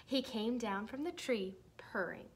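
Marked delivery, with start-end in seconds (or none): none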